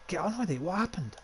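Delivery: high-pitched, impatient questioning; acted impatience, pitch jumping up; impatient demand. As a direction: cut in and demand the news. Son demands news of his father.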